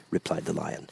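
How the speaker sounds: ordinary delivery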